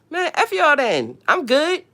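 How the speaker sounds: high-pitched brush-off; mock defiance. Streamer quoting herself refusing to stop, mock defiance. Acts out own defiant dismissal.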